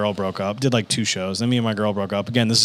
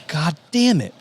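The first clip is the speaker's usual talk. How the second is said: higher mock-exasperated curse; comic frustration; 7 semitones higher, slower than usual. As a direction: play a friend's funny grudging annoyance. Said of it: "he was so funny about it".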